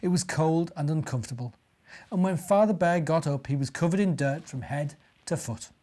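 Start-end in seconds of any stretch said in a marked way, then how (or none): none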